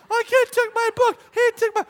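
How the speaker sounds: put-on high, loud, jumbled child shrieks; imitated squabbling kids screaming. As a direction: yell over each other like children fighting. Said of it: "this is what she's screaming".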